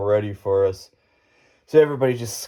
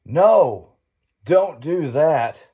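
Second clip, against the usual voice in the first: louder, higher retold yell, the last words slowed right down; the old junkyard owner yelling at the kid; old man yelling; 5 semitones higher, 4 dB louder, much slower than usual. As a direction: shout it like the panicked old guy. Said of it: "we hear the old guy yelling".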